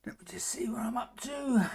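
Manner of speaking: whispered aside to self. Real, not acted. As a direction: whisper it under your breath. Checking notes aside.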